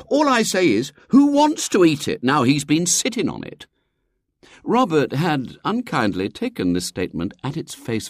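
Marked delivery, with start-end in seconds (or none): 0.1-0.9 put-on higher and louder aggrieved boy; indignant boy. William indignant at brother. 1.1-3.7 much higher, louder aggrieved rant; indignant boy. Acted. William indignant.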